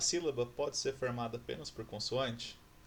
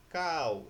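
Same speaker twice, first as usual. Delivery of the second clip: loud, higher, stretched "Cal", sounded as cau; stronger sound demonstration; 4 semitones higher, 6 dB louder than usual. Shown as a demonstration. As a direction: stretch "Cal" so the A stands out before the weaker U. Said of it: "Qual é o som mais forte?". ("Cal" alongado e forte, destacando o A)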